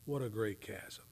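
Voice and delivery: usual speaking voice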